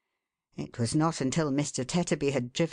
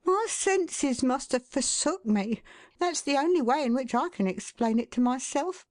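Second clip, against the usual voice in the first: elaborate wife; 10 semitones higher than usual. A narrator's reading. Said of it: "said Missus Tetterby with elaboration".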